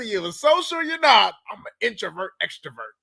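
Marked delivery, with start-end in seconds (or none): 1.5-2.9 soft mocking quote. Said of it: "mocking quote again".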